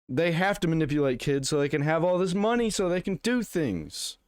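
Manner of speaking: mock indignation. Streamer sarcastically defending Valve to viewers, mock indignant.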